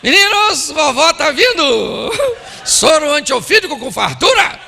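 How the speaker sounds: high, loud, ironic calling out; hammed-up sarcastic announcement. Spouse announcing mother-in-law's visit to kids, loud sarcasm. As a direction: holler to the kids with mock glee. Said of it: "evite ironia".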